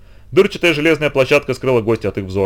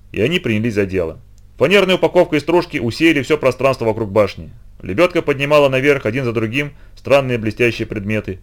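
ordinary delivery, nothing marked

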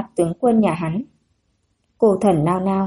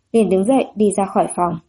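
usual speaking voice

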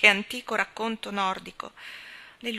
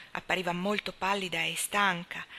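plain reading voice

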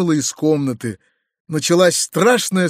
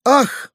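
a short, much higher gasping ah; shocked cry; 9 semitones higher than usual. A narrator's reading. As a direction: cry out and faint away. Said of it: "вскрикнула генеральша". (генеральша в ужасе вскрикивает)

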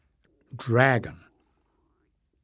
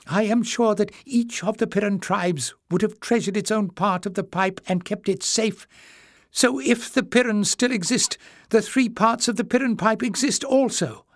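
put-on high old man's voice; eager old scholar, higher; an old librarian, hopeful and earnest; old Joseph, eager; 9 semitones higher than usual.

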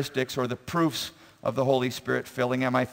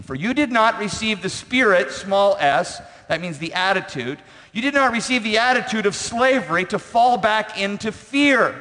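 raised, loud emphatic verse reading; forceful scripture preaching; 7 semitones higher, 5 dB louder than usual. Acted. Preacher reading Romans to the congregation, high and forceful. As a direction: drive the verse home from the pulpit.

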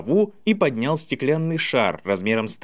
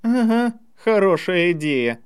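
drawled; 6 semitones higher than usual. A narrator's reading. Draco answers Ernie's talk of a good idea, drawling. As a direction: drag it out, higher-pitched. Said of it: "протянул Драко".

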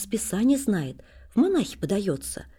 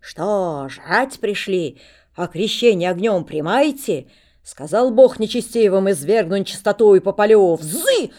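a mad monk raging at the beggars, booming; frightening shout; 2 semitones higher, 8 dB louder than usual. Acted. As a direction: roar at the crowd, louder and louder like a mad prophet. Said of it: "Он страшно кричит на всех".

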